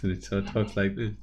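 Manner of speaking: low, hushed creepy little alien. Retold or imitated. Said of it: "the little creepy voice".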